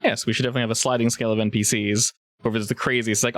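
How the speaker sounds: ordinary delivery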